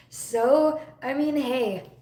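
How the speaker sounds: usual speaking voice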